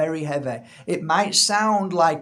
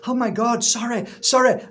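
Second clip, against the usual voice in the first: higher and louder, flustered sorry; panicked apology; 6 semitones higher, 4 dB louder than usual. Retold or imitated. Fish shop owner to unhappy customer, panicked apology. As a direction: blurt it out like you've just been caught. Panicking over cold chips.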